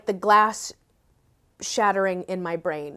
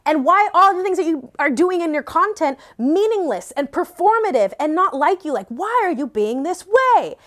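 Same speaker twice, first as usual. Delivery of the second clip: higher, louder, building exasperation; retold shouting at herself; 7 semitones higher, 4 dB louder than usual. Berating herself over her content, retold exasperated shouting. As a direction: demand answers from yourself, retold outburst. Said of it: "I was shouting at myself and I was crying".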